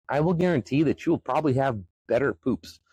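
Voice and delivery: ordinary talk, nothing marked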